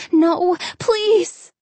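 high, pleading cry; desperate plea. Acted. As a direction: beg them to stop, high and scared. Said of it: "Hannah wanted to cry".

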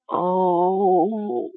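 long, drawn-out groan. A narrator's reading. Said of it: "Mara groaned".

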